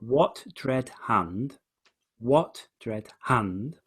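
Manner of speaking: very slow, "what" stressed and separated; repeated slow beats, leading hard on "what". Shown as a demonstration. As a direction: bring out "what", word by word. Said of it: "So he's bringing out".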